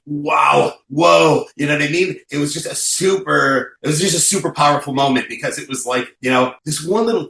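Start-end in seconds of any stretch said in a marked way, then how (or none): 0.1-1.5 amazed. Retold or imitated. Reacting to the couple's tears.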